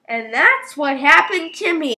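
ordinary delivery

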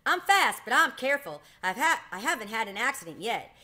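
high, loud proud boast. A narrator's reading. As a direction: puff up and brag loudly. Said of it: "he boasted".

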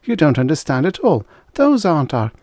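high, urgent interjection; interrupting. A narrator's reading. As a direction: cut in to protest. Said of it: "Cal interrupted".

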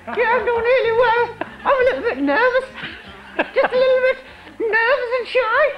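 squeaky nervous ventriloquist dummy; high nervous dummy voice. Acted. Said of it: "speaks in a very high-pitched, nervous voice".

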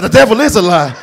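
loud, laughing the devil is a lie; scared exclamation. Retold or imitated. Startled church usher crying out to the Lord.

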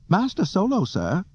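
high, formal robot call; C three P O calling out. A narrator's reading. Droid hailing his master at work, high calling voice. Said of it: "three P O called".

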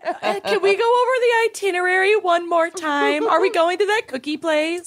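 eager imitation. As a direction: imitate an overeager friend. Impersonating Lucy asking about cookies.

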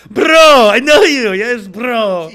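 greeting Armando, loud excited. Acted. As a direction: shout it like seeing an old friend.

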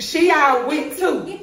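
slow, laughing through the words. Real, not acted. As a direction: laugh out the line slowly. Laughing while saying it.

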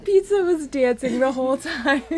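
high voice breaking into laughter; laughing hard. Real, not acted. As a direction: talk through tears of laughter. Laughing hard about the win.

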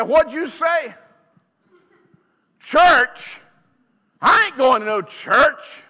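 mock indignant yell of church; outraged refusal. Husband's outraged refusal, voiced.

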